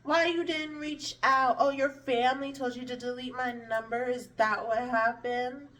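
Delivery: high, put-on innocent surprise; imitating a godmother who pretends nothing is wrong; fake-friendly godmom. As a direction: play it fake-nice and caught off guard. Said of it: "she tries to be like".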